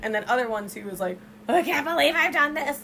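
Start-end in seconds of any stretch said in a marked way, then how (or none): 1.5-2.8 higher-pitched mock panic; imitated panicky outburst; mock freaking out. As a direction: act out a freaked-out confession. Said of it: "just fucking freaking out".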